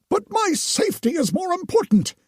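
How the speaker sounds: overly devout priest; pious priest voice. Acted. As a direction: gush with relief like a holy man. Narrator voices the Potter.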